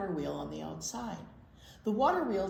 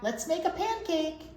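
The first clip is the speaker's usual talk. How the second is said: high, louder eager plea; 10 semitones higher, 5 dB louder than usual. Acted. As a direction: beg for the pancake. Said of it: "shouted Jack".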